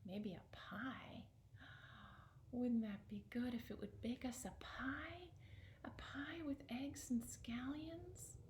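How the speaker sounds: slow, breathy whisper of a hopeful wish; hushed wishing whisper. Acted. Old man wishing aloud over the stove, a slow hushed whisper. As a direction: whisper it like a secret longing.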